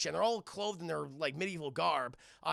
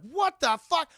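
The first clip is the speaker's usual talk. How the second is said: high, loud mock shock; shocked outcry; 11 semitones higher, 12 dB louder than usual. Demona finding all humans turned to gargoyles, loud shock. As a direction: exclaim it in loud disbelief.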